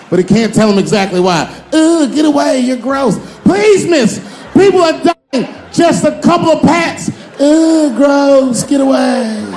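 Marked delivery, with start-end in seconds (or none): 1.7-9.4 high, loud frantic back-and-forth; shrill mock shouting. Women and the hero shouting over a burning building, high. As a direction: shriek it, disgusted and desperate.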